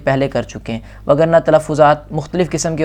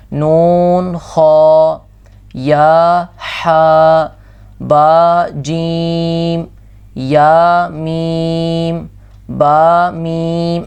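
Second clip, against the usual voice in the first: slow recitation; 3 dB louder, much slower than usual. Shown as a demonstration. Teacher drills letter names.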